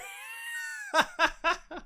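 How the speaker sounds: high screaming laughter; shrieking laugh. Real, not acted. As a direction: lose it completely. Cracking up at Spongebob quote.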